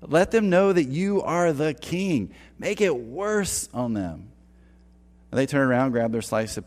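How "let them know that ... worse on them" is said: mock slacker buddies, higher. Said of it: "And they're like".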